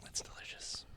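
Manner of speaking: mock sultry whisper. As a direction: breathe it out, teasing. Joking about licking pop filter.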